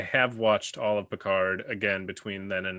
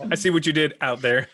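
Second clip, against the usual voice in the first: laughing; 5 semitones higher, 9 dB louder, faster than usual. Real, not acted. Laughing at the pun.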